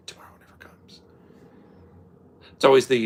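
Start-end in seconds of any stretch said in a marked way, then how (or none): 0.1-1.0 "Tomorrow never comes" fully whispered, no voice. Shown as a demonstration. Sharing an old saying with viewers in a whisper. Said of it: "Remember that shit?".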